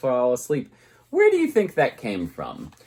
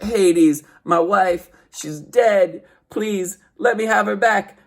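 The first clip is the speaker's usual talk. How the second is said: higher, louder desperate plea; Orpheus pleading; 9 semitones higher, 6 dB louder, slower than usual. Retold or imitated. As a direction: beg the god for your wife, high and loud. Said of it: "he's like".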